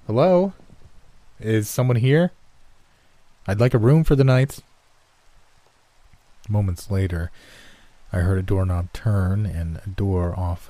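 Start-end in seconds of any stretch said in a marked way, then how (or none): 0.1-4.6 raised voice, pitched up; calling out. Acted. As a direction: call out into an empty lobby. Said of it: "called out".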